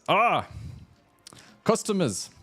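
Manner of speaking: high-pitched welcoming smith with mock Russian accent; attempted Russian accent. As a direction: greet customers in a shaky attempt at Russian accent. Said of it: "I'm trying to do a Russian accent".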